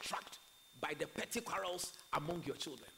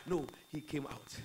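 ordinary talk, nothing marked

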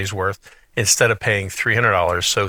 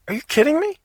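surprised; faster than usual. Retold or imitated. Uncle hears the cheap price.

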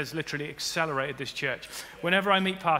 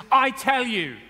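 commanding Jesus; 8 semitones higher, 7 dB louder, slower than usual. Acted.

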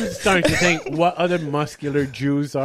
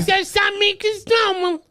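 very high, meek nerd caricature; Jerry Lewis type; 3 dB louder than usual. Acted. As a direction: squeal it like a comic weakling. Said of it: "Jerry Lewis, uh, type voice".

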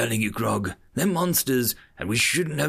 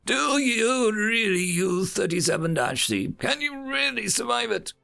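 shouting; 13 semitones higher than usual. A narrator's reading. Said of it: "shouted over the murmuring crowd".

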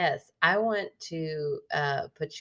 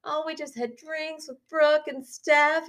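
high, slow breezy brag; mock breezy; 10 semitones higher, 3 dB louder, slower than usual. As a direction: say it like a smug friend. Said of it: "I got".

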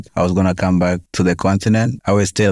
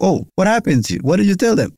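worried sister; 12 semitones higher than usual. Retold or imitated. Sister voiced in retold story.

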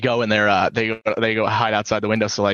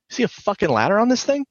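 higher-pitched smart-aleck question; retold wisecrack; 11 semitones higher than usual. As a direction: imitate an uncle sassing a cop. Uncle sasses cop about fire.

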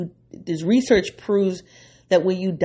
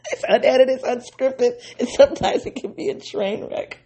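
high-pitched, laughing through the words; 8 semitones higher than usual. Real, not acted. Host admitting to listeners it's unscripted, laughing. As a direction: giggle helplessly while confessing. Laughing over own messy podcast.

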